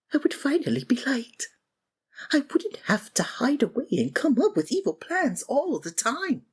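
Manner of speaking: normal talking voice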